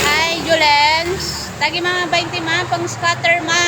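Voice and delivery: high, loud excited call. Real, not acted. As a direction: shout it out to mama during a game. Calling out excitedly during the game.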